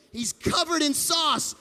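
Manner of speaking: plain reading voice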